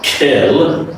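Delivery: "kill" drawn out as "kel"; wrong vowel demo. Shown as a demonstration. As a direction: open the vowel of "kill" towards e, slowly. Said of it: "this is not kill".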